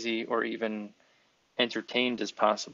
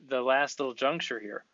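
normal talking voice

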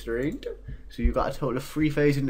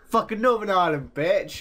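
frustrated outburst; 7 semitones higher, 4 dB louder than usual. Real, not acted. Reacting to game.

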